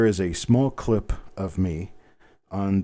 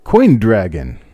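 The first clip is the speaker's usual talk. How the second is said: booming announcer voice, much louder; big voice announcer; 11 dB louder, much slower than usual. Pretend. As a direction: boom it out like a TV announcer. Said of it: "That's right, us big guys. Big voice guys".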